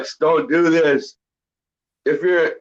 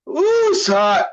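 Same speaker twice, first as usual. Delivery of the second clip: yelp of pain; 13 semitones higher than usual. Real, not acted. Burning mouth from the chip.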